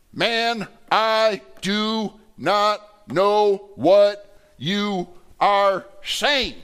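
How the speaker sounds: loud, high, word by word; agitated denial. Acted. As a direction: hammer out each word slowly, loud and mad. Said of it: "Peter gets agitated. He gets mad".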